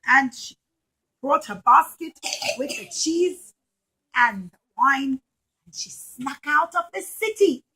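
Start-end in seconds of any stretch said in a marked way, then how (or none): none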